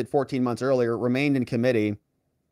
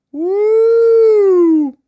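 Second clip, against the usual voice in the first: a loud, much higher whoop of glee; excited whoop; 9 dB louder than usual. Real, not acted. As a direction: let out a gleeful cheer. Celebrating a possible tie outcome.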